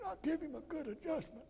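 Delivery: imitating Sterling. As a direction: pitch way up and soft, mimicking the friend. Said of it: "It's the way he talked".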